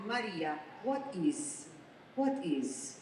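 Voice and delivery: put-on light Armenian accent, puzzled question; Armenian babysitter. Babysitter helping clean a messy closet, light Armenian accent. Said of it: "babysitter was from Armenia".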